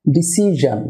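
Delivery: ডিসিশন said "di-SI-zhan"; zh, not sh, in ডিসিশন; zh in -sion. Shown as a demonstration. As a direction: end ডিসিশন on a buzzing "zhan". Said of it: "এসআইওএন সাফিক্সের উচ্চারণ হবে".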